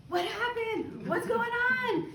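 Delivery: high, alarmed husband voice with a laugh; Jeremy's panicked questions, mimicked in a high voice; imitating worried husband. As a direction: play the startled husband, pitched well up.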